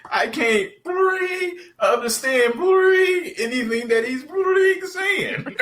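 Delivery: slow, high-pitched rant cut by long held bleeps; imitating censor bleeps. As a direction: do the censored studio scene, beeping over the swear words yourself. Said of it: "with the phone ringing to bleep them out".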